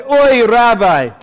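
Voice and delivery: put-on loud, high groaning old-world accent; Dracula-like accent. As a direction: wail it in a thick put-on accent. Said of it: "sounds like Count Dracula".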